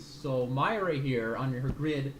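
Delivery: normal talking voice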